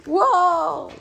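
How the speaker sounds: loud startled exclamation; sudden real surprise; surprised whoa. Real, not acted. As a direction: caught off guard as the dog bolts. Dog suddenly runs off.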